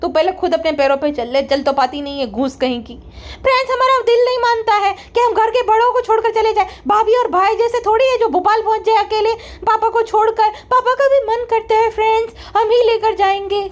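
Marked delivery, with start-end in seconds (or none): 3.4-13.6 higher, sugary "friends" vlogger impression; mocking her sister-in-law's vlog voice, pitched higher. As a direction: ham up a fake-sweet influencer addressing fans.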